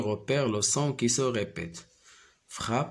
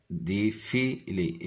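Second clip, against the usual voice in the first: slow syllable-by-syllable; much slower than usual. Shown as a demonstration. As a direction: slowly stretch out Défilé, de-fi-lé. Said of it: "j'entends le son F dans le mot".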